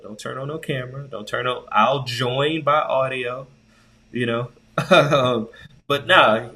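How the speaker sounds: ordinary delivery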